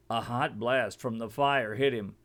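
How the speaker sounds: usual speaking voice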